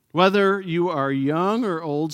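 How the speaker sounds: usual speaking voice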